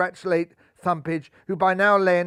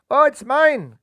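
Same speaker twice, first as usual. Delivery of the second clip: high, loud eager shout; a rabbit crying out for the ball; excited cry; 8 semitones higher, 7 dB louder, slower than usual. A narrator's reading. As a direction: shout it as you dive in. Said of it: "cried Rajbun".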